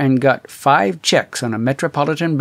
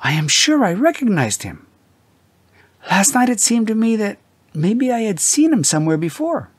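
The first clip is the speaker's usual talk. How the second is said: higher, breathless wife's voice; an unsteady wife gasping to her husband; gasped out; 9 semitones higher than usual. A narrator's reading. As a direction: blurt it out still shaken. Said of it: "gasped out".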